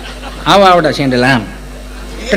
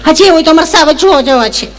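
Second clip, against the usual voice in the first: a higher, mincing educated lady; prim city lady; 8 semitones higher than usual. Retold or imitated. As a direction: mimic a fussy city woman picking each word. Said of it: "Gujarati ચીપી ચીપીને બોલે". (શહેરી બેનની ચીપી ચીપીને બોલતી નકલ)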